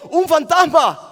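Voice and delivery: loud, high cry of alarm; acted frightened shout. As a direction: shout it as disciples seeing a ghost. (grito de susto actuado)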